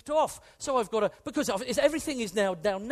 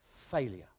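normal talking voice

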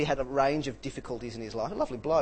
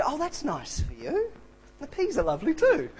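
high mock-polite dismissal; 10 semitones higher than usual. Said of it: "mock polite dismissal".

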